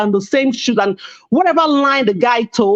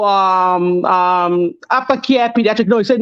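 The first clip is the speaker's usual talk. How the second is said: usual speaking voice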